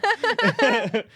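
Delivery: a high, breathy burst of laughter. Real, not acted. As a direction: laugh out loud at the story. Laughing at own story.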